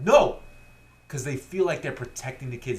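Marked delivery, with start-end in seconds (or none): 0.0-0.4 loud, higher sharp no; parents shouting no. Retold or imitated. Parents stopping the kid reaching for wine, loud shout. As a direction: stop him grabbing the wine. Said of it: "and they're like".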